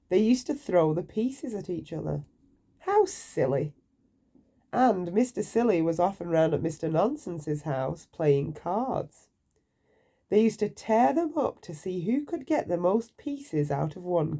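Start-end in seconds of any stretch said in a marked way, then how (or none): none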